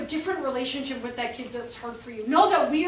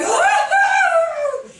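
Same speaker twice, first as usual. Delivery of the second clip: loud, high mock scream; acted frustrated scream; 11 dB louder than usual. Stressed parent arriving at work, acted scream. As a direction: let out a big pent-up yell.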